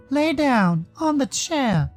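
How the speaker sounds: high, deliberate repeated instruction; high-voiced character.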